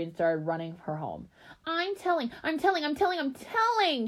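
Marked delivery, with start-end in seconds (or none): 1.6-4.1 put-on very high little-girl tattling screech. A narrator's reading. Five-year-old runs home to tattle, screeching. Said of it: "she screeched".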